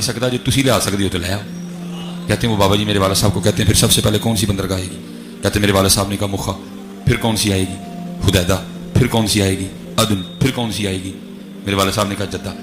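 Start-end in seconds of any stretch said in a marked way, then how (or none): none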